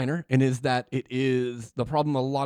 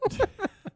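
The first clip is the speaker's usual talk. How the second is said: high-pitched burst of laughter. Real, not acted. Sandwich joke.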